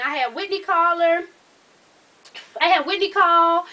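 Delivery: ordinary talk, nothing marked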